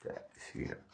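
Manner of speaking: whispered, toneless; whispered repeat of the chant. Real, not acted. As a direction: breathe the words without voice.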